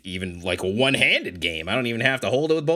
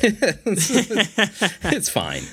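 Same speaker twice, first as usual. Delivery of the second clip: laughing it off; 12 semitones higher than usual. Real, not acted. Laughing self-deprecating verdict.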